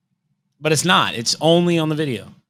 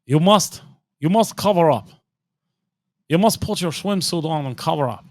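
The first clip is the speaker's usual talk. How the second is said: higher, emphatic mock Russian accent; 7 semitones higher, 5 dB louder than usual. Telling the kids to get ready for the pool, Russian accent. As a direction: order them about in a put-on Russian accent. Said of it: "walking around talking Russian".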